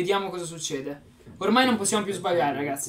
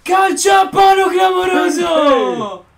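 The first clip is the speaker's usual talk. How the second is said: breathless italian goal commentary; 9 semitones higher, 10 dB louder, much slower than usual. Real, not acted. Last chance, commentating own game.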